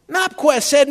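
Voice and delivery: usual speaking voice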